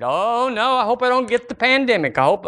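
high, fast fretful worrier; mock frightened church member; mock panic. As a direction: play the scared believer, half mocking. Mocking fearful churchgoer.

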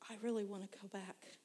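soft, hushed wish; quiet longing. Retold or imitated. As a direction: say it softly to yourself. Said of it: "I'd start thinking".

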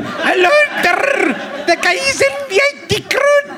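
very high, slow Glaswegian caricature; mock Glaswegian. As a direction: drag the words out in a squealing mock Glaswegian. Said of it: "this is how I'd have done the Glaswegian guy".

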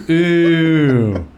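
high, drawn-out mock crowd ooh; crowd's ooh. Audience reacting to a harsh roast joke with a mock ooh. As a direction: play the whole audience reacting. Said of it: "The crowd goes".

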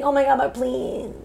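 high, mock pleading; begging girl. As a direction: plead like a daughter wanting permission. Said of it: "so beautifully begging".

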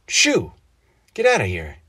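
loud, high shoo then a firm order; ordering shout. A narrator's reading. Father to crow at the window, shouting it away. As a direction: chase the bird off. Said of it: "he ordered".

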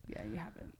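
breathy whispered aside; whispering. Real, not acted. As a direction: whisper it teasingly. Teasing aside, whispered back.

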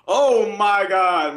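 loud, high, slow oh my God; drawn-out amazed gasp of words; stunned oh my God. Retold or imitated. Retelling seeing the huge bass surface, loud stunned exclamation.

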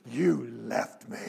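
low, slow, drawn-out accusation; hurt child. Retold or imitated. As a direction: whimper it slowly like a wounded little boy. Sobbing child accuses father, first time.

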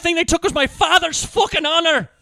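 cartoon Irish accent. Riffing on the Irish accent, loud mock brogue. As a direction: moan it in thick mock Irish. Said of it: "god-awful accent".

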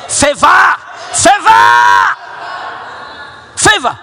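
full-throated congregational shout; shouted declaration. Shown as a demonstration. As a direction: shout it out to the crowd. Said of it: "Raise your hands and say".

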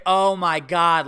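slow, loud imitation of a fed-up wife; mock exasperation. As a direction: mimic her as if mid-fight with a husband. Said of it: "like I'm your fucking husband you're fighting with".

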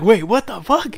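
high-pitched retold double take; mock-stunned, voice jumping up; startled disbelief. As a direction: relive the shock with a rising question. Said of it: "we're just like".

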